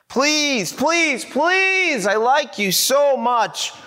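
pleading climbs into a high whine; begging. Acted. Young man begging for kiss.

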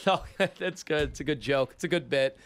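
acted light chuckle through the words; laughing it off. Newcomer takes the demand for a joke, imitated laughing dismissal. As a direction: brush it off as a joke, half-laughing. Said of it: "And I laugh and I go".

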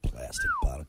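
very high, soft mocking squeal; squeaky mock voice. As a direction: squeak it out in mockery. Mocks his co-host's complaint.

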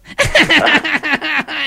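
pleased little laugh after the punchline; chuckle at own joke. Acted. Prank caller laughs at own joke.

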